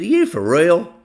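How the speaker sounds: a big man caught off guard, asking if it's real; incredulous. Retold or imitated. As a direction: voice jumps high and loud in disbelief. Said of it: "He said".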